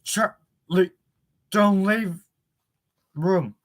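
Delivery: broken-up radio message, words cut by gaps; choppy walkie-talkie voice. A narrator's reading. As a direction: break it up like a sputtering walkie-talkie. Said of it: "choppily from the little box".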